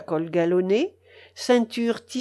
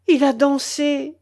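tearful; 6 semitones higher, 5 dB louder than usual. Acted. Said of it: "avec des larmes dans la voix".

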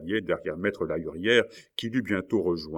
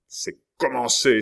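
higher, louder announcement; exclaimed cry; 5 semitones higher, 6 dB louder than usual. A narrator's reading. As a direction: cry out that it has begun. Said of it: "s'écria Maurevel". (s'écrier, voix haute et forte)

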